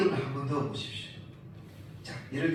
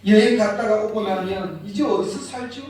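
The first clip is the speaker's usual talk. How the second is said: much louder, exasperated question; mock alarmed outcry; 3 semitones higher, 11 dB louder than usual. Preacher loudly acts out the worry of the homeless couple. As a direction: throw the question out like a panicked wife. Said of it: "mock alarm about selling house".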